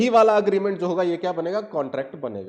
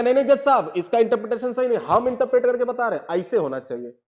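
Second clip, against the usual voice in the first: objecting lawyer; 7 semitones higher, 5 dB louder than usual. Retold or imitated. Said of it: "दूसरा बोलेगा".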